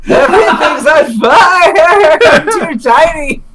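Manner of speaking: high slow squeaky shout; tiny panicked character. Acted. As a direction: squeak out the alarm.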